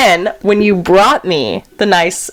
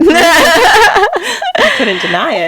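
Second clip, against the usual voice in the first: high, slow, laughing through the words; 8 semitones higher, much slower than usual. Real, not acted. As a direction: crack up while saying it. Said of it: "friend laughs at the story".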